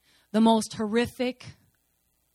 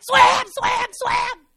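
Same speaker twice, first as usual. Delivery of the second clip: high, very loud urgent yelling; frantic shout; 11 semitones higher, 12 dB louder, much slower than usual. Retold or imitated. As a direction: scream at her to get out now. Urging friend out of water.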